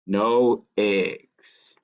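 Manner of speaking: ordinary delivery, nothing marked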